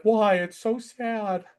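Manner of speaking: high mock lament, drawn out at the end; hammed-up heartbreak in a pet's voice; mournful cat. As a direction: moan it high like a melodramatic animal. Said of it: "And he's like".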